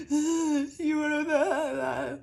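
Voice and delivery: high, slow, whiny fake sob; hammed-up tearful plea from a manipulative relative; mock guilt-trip crying. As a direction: wail it like a clingy parent begging you to stay. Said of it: "trying to keep you like".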